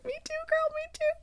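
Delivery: very high squeaky aside; tiny, high-pitched fangirl voice; gushing aside. Real, not acted. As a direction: squeal along in sympathy with the heroine. Reader gushes over the romance.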